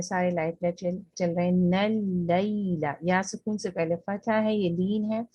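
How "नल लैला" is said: नल लैला drawn out slowly, the ऐ softened; slow Quranic recitation. Shown as a demonstration. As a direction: stretch नल लैला gently like recitation. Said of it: "तो इसको आपने नल लैला".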